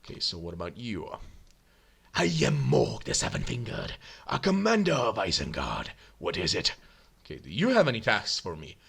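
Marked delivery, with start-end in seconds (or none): 2.1-6.7 put-on low, rough accented commander voice; gruff Uruk introduction; orc commander. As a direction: announce yourself in a deep, put-on growl.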